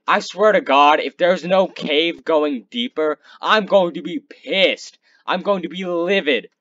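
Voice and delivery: loud, angry rant at the game; frustrated outburst. Real, not acted. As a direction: fume loudly about the missing cave. Angry rant about no cave.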